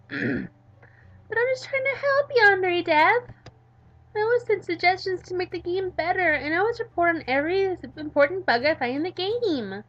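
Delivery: put-on a squeaky girl pleading; high Midori take. Shown as a demonstration. Midori pleading with Yandere Dev, squeaky and loud. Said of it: "I'm gonna go with this one".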